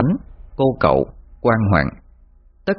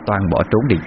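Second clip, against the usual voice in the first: ordinary delivery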